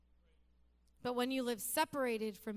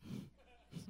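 whispered disbelief; 3 semitones lower, 11 dB quieter than usual. Retold or imitated. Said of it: "I was like".

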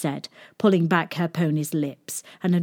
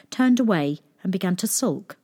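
plain reading voice

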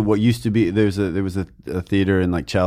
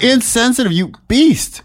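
mock outrage; 12 semitones higher than usual. Mock scolding Sam.

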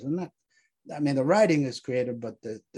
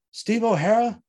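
startled recognition; 8 semitones higher, 6 dB louder than usual. Acted. Recognizes ghost of fallen soldier.

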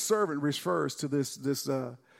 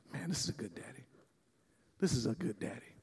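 much softer, lower, savoring the words; tender hush; 5 semitones lower, 12 dB quieter, faster than usual. Real, not acted. Preacher marvels at the good father, tenderly soft. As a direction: say it like a quiet marvel. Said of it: "preacher softens about father".

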